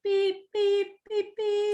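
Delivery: high, steady mock censor beeps; censor bleeps. Trash talk bleeped out, high mock beep. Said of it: "every other word's just gonna be like".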